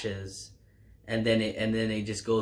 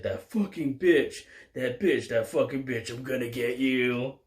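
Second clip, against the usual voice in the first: mock villain snarl, pitched up; hammed-up bloodied man swearing revenge; angry threat; 2 semitones higher than usual. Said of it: "He's like".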